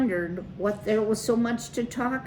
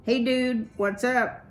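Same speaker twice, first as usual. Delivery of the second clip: high-pitched, mildly raised hail; crying out a greeting; 6 semitones higher, 3 dB louder than usual. A narrator's reading. Clutch greeting the band in the far corner, calling out. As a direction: call out cheerily to bandmates. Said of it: "Clutch cried".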